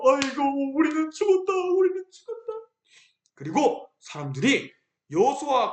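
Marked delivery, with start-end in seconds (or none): 0.0-1.5 high wailing cry "우리는 죽었다"; mock wailing. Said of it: "통곡을 했어요". 1.5-2.5 "우리는 죽었다" higher, climbing into a wail; rising wail. Acted. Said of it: "통곡을 했어요".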